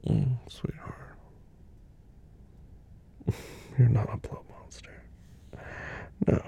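soft reassuring whisper. Acted. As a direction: whisper close and tender. Whispered comfort throughout.

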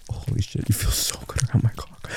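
breathless whisper. Acted. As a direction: gasp it out softly. Overwhelmed intimate moment.

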